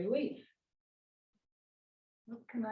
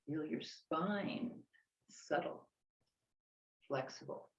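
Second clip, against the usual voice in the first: serene tai chi cues; 4 semitones lower than usual. Acted. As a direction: guide the movement gently.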